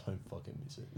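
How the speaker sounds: hushed, barely voiced urging; will him on under your breath; whispered plea. Spotter of a much heavier bar, whispered.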